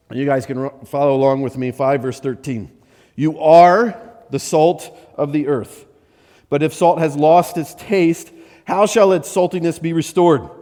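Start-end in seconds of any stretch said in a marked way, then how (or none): 3.2-10.5 scripture reading. Acted. Reads Matthew aloud.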